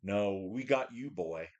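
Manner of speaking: a dark presence gloating as it drags him back; mock inner taunting voice. The lifter voices depression taunting him, low and mocking. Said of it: "there's something going".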